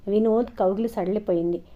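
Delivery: usual speaking voice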